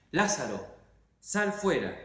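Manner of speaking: called out loud, pitch up high. Acted. Jesus calls Lazarus out of the tomb, loud cry. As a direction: command the dead man out of the tomb. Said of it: "gritó con todas tus fuerzas".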